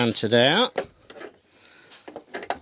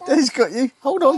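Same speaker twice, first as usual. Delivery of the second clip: high, gentle reassurance; 12 semitones higher, 3 dB louder than usual. Real, not acted. Dad reassures scared child on bike.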